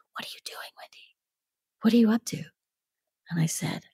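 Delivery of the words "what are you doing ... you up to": hushed, barely voiced probing; retold secretive whisper; conspiratorial whisper. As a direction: lean in and whisper the question. Actor leans over to question her secretly.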